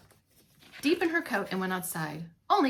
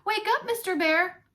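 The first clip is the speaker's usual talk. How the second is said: calling out; 9 semitones higher, 3 dB louder than usual. Acted. Waking sleeping husband.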